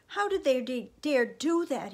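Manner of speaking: loud, high outrage; angry cry. A narrator's reading. Sam raging at the soldiers once home, angry. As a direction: cry it out in fury. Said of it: "all Sam's fear changed to anger".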